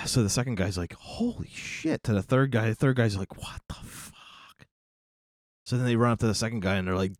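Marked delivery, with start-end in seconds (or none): 0.9-2.0 slow, hushed whisper; horrified whisper. Acted. Second guy to the third guy, horrified slow whisper. 3.4-4.7 very slow breath-only whisper; stunned whisper. Acted. Third guy watching in horror, slow stunned whisper.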